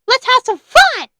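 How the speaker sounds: put-on rally the kids with a big bright shout; cheery announcement. A narrator's reading. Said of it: "Joy announced".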